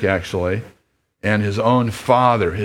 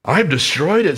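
higher, earnest plea; pleading father; 7 semitones higher than usual. Acted. Lester begging his son, higher and pleading. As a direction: insist you've made amends.

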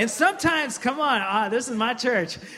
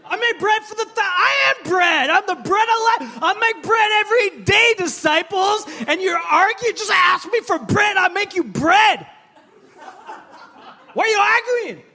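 much higher, loud exasperated shout; Jesus shouting; 13 semitones higher, 9 dB louder than usual. Retold or imitated. Preacher as Jesus yelling at disciples, exasperated shout. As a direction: yell it at the forgetful disciples. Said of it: "He's like".

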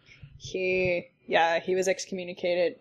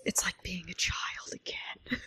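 awed whisper; 8 dB quieter than usual. Retold or imitated. Quoting husband high, whispered.

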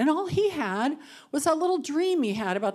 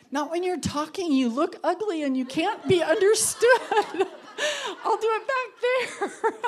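high, louder teary laughter; laughing through tears; 9 semitones higher, 6 dB louder than usual. Real, not acted. Joking to the group about ugly crying, laughing high. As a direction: laugh it off while fighting tears. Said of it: "I am gonna cry".

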